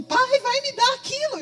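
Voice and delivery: high, loud cry to his father; the boy cries out that the fit is coming; retold yell. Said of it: "E o rapaz gritava".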